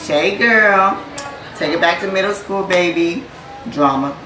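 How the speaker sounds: excited shout-out. Real, not acted. Toasting the bride at the wedding, loud excited call. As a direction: shout her name out with joy.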